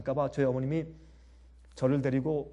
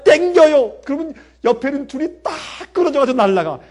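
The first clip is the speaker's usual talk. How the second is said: higher, louder, thrilled telling; excited; 9 semitones higher, 10 dB louder than usual. Real, not acted. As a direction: relive the thrill of the kite fight. Thrilling kite fight memory.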